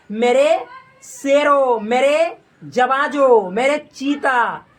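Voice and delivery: slow, stretched-out hailing of the troops; rousing address. Real, not acted. Teacher hailing students as his lions, slow rousing address. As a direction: draw out each pet name grandly.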